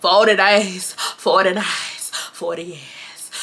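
slow, drawn-out mock commenter; slow mocking complaint, fading at the end; nagging commenter. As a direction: stretch each phrase out, mimicking a complainer. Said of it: "somebody in the comment section gonna be like".

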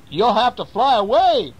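high mouse ordering the bees away; mouse telling bees off. Acted. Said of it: "said the mouse".

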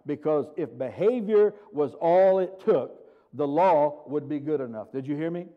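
ordinary talk

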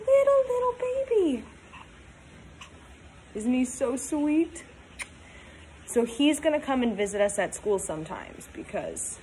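plain reading voice

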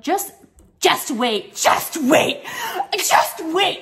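high, laughing sing-song "just wait", mocking and smug; mocking the moms. The vlogger mocks the mothers who warn her, in a high laughing sing-song. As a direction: taunt them with a laughing, teasing "wait". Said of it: "were coming for me and be like".

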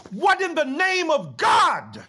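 crying out to heaven in disbelief; outraged cry. Acted. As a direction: raise the voice and drag out each word. Outraged climax of the reading.

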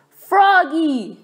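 high, loud call of Froggy. A narrator's reading. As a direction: call across the classroom. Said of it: "called his teacher".

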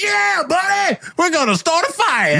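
very high, whooping firestarter voice; high-pitched hammed-up bandit holler; rowdy firestarter accent. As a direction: yell it like a gleeful pyromaniac gang leader. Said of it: "why are they talking like this?".

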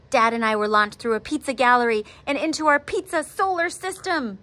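plain reading voice

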